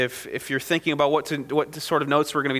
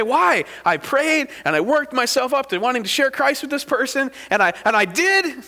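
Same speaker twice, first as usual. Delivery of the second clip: higher-pitched, pleading complaint; acted discouraged protest; 6 semitones higher than usual. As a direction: voice a frustrated believer asking why. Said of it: "And you're discouraged about it".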